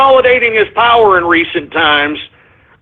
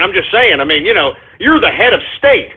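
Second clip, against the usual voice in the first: ordinary delivery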